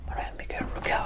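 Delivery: voiceless whisper, leaving room to repeat; whispered prompt. Shown as a demonstration. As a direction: whisper it along under the learner's turn. Whispers during the learner's turn to repeat. (susurrando para que repitan)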